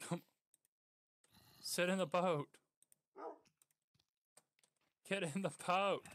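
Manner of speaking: exasperated owner raising voice at pet; firm loud command. Real, not acted. Ordering stubborn dog into boat.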